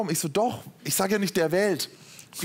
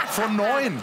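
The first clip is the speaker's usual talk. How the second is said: laughing out the score, a bit higher; real laugh breaking into the words; 6 semitones higher than usual. Real, not acted. Admitting his test score to the host, laughing.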